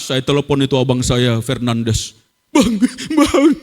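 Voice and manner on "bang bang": high wailing sobs on the phone; re-enacted crying. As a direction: imitate bawling to an older brother. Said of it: "Karena saya nangisnya kayak gitu". (menirukan tangisan meraung)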